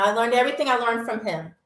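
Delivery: usual speaking voice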